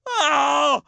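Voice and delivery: loud, high burst; sudden dismay; startled shout. Real, not acted. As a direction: yelp at the clock. Realizes time ran out.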